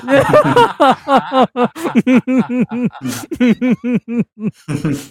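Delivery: high, helpless burst of laughter; long high laugh. Real, not acted. Listener cracks up.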